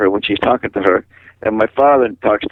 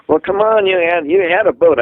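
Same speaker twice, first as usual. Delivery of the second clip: higher, coaxing appeal; retold coaxing; 6 semitones higher than usual. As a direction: prod him to remember, pitch lifted. Retold coaxing of his father.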